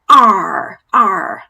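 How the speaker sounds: loud pirate "R" growl. Shown as a demonstration. Reading a pirate story to a child, growling "R". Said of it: "how a pirate might sound".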